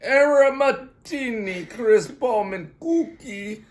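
slow, high, loud mock Italian; fake Italian accent. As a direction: ham up the Italian product name. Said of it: "That was a lot of different accents".

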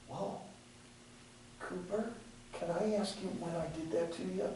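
soft, quiet, caring question to a friend; hushed, caring question to a hurt friend; gentle question. Shown as a demonstration. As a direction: ask it gently, without defending yourself.

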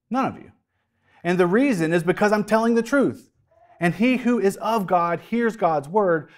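firm, rising preacher's voice; preaching Jesus' rebuke. Acted. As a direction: preach it as Jesus confronting them.

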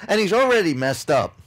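ordinary delivery

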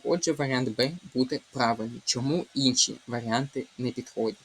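ordinary talk

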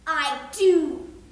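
put-on loud, blunt old miser; curt Scrooge. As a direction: slam the answer down.

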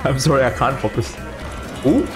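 ordinary talk, nothing marked

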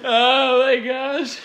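slow and drawn out, still laughing; real amused groan; amused disbelief. Real, not acted. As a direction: stretch it out in disbelief. Cracking up at the scene.

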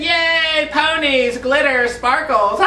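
put-on high bubbly girly squeal. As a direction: squeal it like a little girl. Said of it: "Talk like a girl".

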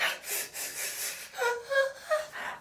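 high squealing laugh-cry; shrieking laugh at cold water. Retold or imitated.

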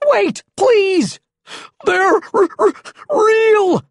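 very high, loud stammering panic; high-pitched terrified yell; panicked cry. A narrator's reading. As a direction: shriek it, stuttering in terror. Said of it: "Panic struck".

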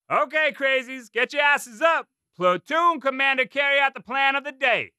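high, loud drill sergeant bark; drill sergeant yelling. A narrator's reading. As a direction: bark out orders like a gunny. Drill sergeant giving orders.

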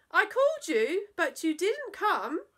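very high, loud, slow mum's call; mother's sing-song welcome. Acted. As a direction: greet the child brightly like a fussy mother. Mum scolds mildly.

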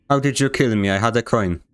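usual speaking voice

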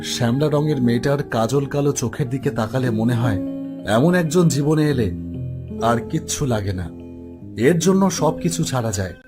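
plain reading voice

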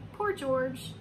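ordinary delivery, nothing marked

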